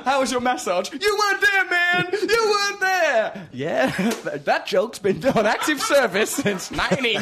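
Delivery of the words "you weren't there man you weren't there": mock shout. Mock yelling at the co-host. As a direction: bellow it like a shell-shocked vet. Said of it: "[name] will shout".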